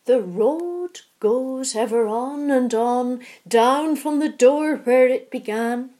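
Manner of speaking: slow, loud, grand verse recital; old lady reciting the verse dramatically; dramatic recitation. A narrator's reading. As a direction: declaim the verse like a bard. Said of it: "recites the whole verse in a dramatic voice".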